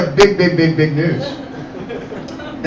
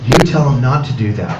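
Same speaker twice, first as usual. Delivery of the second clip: low, offhand mom voice; dismissive mom; 7 semitones lower than usual. Retold or imitated. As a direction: brush the kid off, low. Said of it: "tell on mom".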